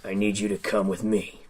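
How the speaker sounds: low, soft gruff command; quiet menacing growl, close in; gravelly sly hobgoblin. Acted. Hobgoblin orders the player.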